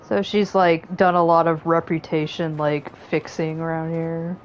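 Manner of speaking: usual speaking voice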